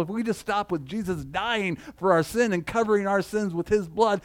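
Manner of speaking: ordinary talk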